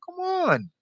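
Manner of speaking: soft, very high-pitched exasperation; squeaked, exasperated appeal; exasperated plea. Real, not acted. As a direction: let it leap up high and quiet, pleading. Exasperated at people wasting time.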